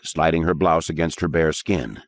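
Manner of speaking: ordinary delivery, nothing marked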